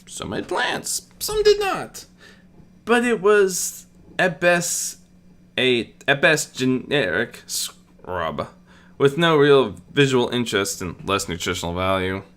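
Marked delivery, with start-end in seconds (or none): none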